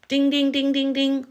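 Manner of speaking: high chiming "ding, ding"; game-show bell. Pretend. Streamer rewarding a viewer's comment with a chiming ding. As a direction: ring out the answer as a prize bell.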